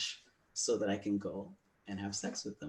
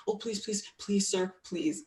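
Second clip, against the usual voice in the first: much higher begging, louder on sir; mock begging; 11 semitones higher, 4 dB louder than usual. Speaker begging partner, high mock pleading. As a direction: whine please sir up high like a pleading boy. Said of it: "And I was like".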